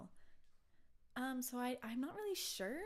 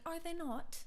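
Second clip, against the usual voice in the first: high, put-on British asking back; lightly voiced posh British query; mock British phrasing; 9 semitones higher than usual. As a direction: mimic a polite Brit's tag question. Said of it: "saying things kind of backwards".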